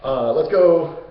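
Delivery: loud, high drawn-out cheer; triumphant heroes. Retold or imitated. Two heroes back in the surface world, triumphant. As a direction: whoop it out in mock celebration. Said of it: "Theseus and Hercules are like".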